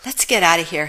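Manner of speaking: ordinary delivery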